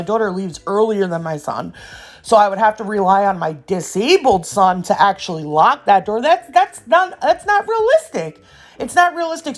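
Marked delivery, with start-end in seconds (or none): 6.2-9.6 exasperated, high. Real, not acted. Exasperated rant about neighbors.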